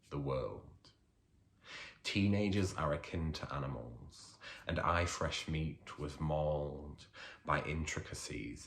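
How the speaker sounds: poem reading. Acted. Poet reading his poem on bullying to a festival audience, measured and deliberate. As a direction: let each line land with pauses. Reads poem Bullies aloud.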